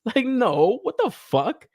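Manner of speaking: usual speaking voice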